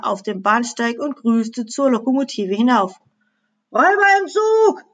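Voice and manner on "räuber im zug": high, loud cry of alarm; desperate scream. A narrator's reading. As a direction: shout the warning from a passing train. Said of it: "schrie der Lokomotivführer und winkte verzweifelt". (verzweifelt geschrien)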